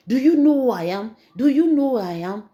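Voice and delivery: loud, high defiant yell; retold shout. Son shouting at his mother over the holy water. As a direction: shout it back as the possessed son. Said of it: "you dey shout for".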